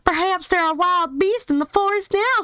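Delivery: put-on high, bright little girl voice for Dorothy; Dorothy's line redone in her proper voice. Shown as a demonstration. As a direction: lift into a young girl's voice. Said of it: "Wrong accent".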